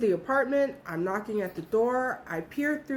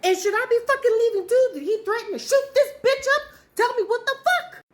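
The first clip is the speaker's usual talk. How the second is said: rising, louder panic with a near sob; panicked; 14 semitones higher, 6 dB louder than usual. Real, not acted. Narrator blocking the door, demanding answers in rising panic. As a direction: fear spilling over, voice climbing high. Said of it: "Her panic is making me panic".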